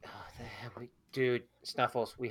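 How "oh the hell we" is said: hushed whisper. Real, not acted. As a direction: whisper it under breath. Whispered aside.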